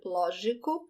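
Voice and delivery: lógico with a soft zh G; lógico, G like s in measure; lógico, softly, zh sound. Shown as a demonstration. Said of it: "soft in front of the E and the I".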